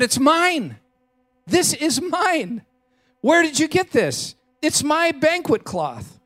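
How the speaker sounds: high, urgent cry of disbelief; stunned recognition. Acted. Woman finding her lost banquet cloth, high and astonished. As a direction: she can't believe it's her own cloth.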